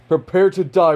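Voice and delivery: high, loud battle threat; shouted threat. Acted. Sorcerer challenging Sukuna to fight, shouted threat. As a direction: call out a challenge to an enemy.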